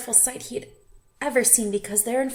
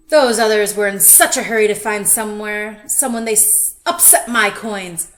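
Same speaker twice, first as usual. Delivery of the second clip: loud, grumpy complaining; grumbling lobster; 11 dB louder than usual. A narrator's reading. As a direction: gripe like a cranky old lobster. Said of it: "the lobster grumbled".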